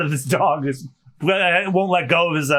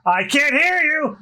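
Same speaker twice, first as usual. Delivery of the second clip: slow, high shout at the crew; captain's shout; 9 semitones higher, slower than usual. Acted. Captain demanding louder answer.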